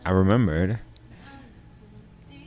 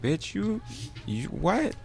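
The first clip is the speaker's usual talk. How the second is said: much higher, a confused annoyed squeak; replaying the moment the boy walked off; annoyed confusion; 10 semitones higher, 8 dB quieter, slower than usual. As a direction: squeak it out baffled and put out. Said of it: "I was annoyed".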